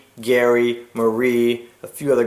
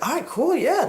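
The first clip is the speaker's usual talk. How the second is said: high casual agreement. Retold or imitated. Marie casually agrees to visit.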